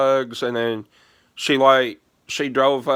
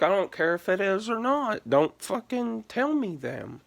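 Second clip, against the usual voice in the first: much higher, mock-pleading exasperation; exasperated refusal; 8 semitones higher, 4 dB quieter than usual. Refusing to hear the passwords, high exasperated plea. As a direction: beg her to stop, up high and a little softer.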